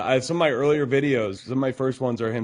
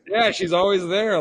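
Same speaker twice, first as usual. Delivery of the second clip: high, louder clueless husband; mock husband voice; 9 semitones higher, 4 dB louder than usual. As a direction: play the oblivious husband. Acting the oblivious husband.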